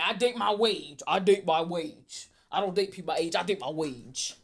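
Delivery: imitating a cocky, bold declaration; bold Shannon impression. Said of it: "he was very bold".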